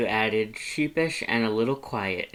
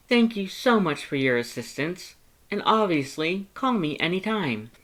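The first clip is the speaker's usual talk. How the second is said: high, bright-toned loud thanks; eager to help; cheerful Papyrus; 8 semitones higher, 4 dB louder than usual. Acted.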